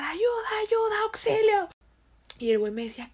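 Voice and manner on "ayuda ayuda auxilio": imitated cry for help. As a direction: reenact calling for help with what little voice you had. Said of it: "con lo poquito que podía empecé a gritar".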